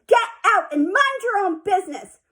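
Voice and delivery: high, loud angry shout; girl shouting at her sister. A narrator's reading. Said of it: "I shouted".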